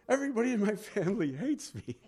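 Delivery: high, comic imitation of an upset woman; mock distress, pitched high for laughs; worked-up caller. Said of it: "she's crying and laughing and all this".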